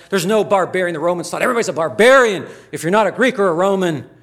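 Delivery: loud, high and quick, driving the point home; fiery preaching. Acted. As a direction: hammer it from the pulpit. Preaching at full force.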